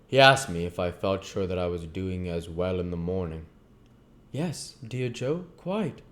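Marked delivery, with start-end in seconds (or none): 4.3-6.0 higher, slow and tender; reassuring youth; young Pip voice. Acted. Pip answers Joe.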